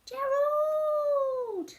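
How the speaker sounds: calling out. Acted. Piggie calls her friend.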